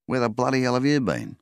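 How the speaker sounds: loud, glad call to a dog that wandered off; happy loud call to his dog. A narrator's reading. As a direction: shout it across the yard to the dog. Calling out to returning dog.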